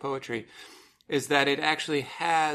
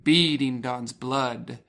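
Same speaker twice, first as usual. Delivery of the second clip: slow, louder reading that punches each beat; strong beats read; 4 dB louder, much slower than usual. Shown as a demonstration. Host reads the poem line to listeners, slow beats stressed. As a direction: read it slowly and hammer the two strong beats. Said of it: "So beating and blood".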